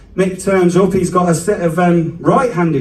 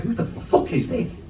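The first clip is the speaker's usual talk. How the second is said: indignant dog; 8 semitones higher, 10 dB quieter than usual. Pretend. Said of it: "was like".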